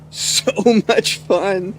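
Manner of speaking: slow, laughing through it; giggling with delight. Real, not acted. Delighted after the roleplay scene.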